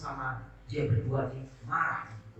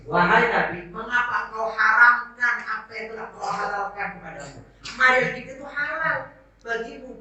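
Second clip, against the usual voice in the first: voice of rebuke; 8 semitones higher, 3 dB louder than usual. Acted. Allah's rebuke voiced in sermon.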